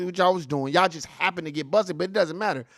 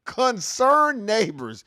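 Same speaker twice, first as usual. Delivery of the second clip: very slow mocking echo; sarcastic; 5 semitones higher, 3 dB louder, much slower than usual. Real, not acted. Streamer repeating the news phrase, sarcastic and slow. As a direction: drag it out with scorn.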